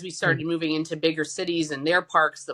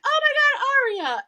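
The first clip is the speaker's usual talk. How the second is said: astonished cry; 5 dB louder than usual. Retold or imitated. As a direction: gasp it out as the miracle hits you. Seeing daughter's face healed.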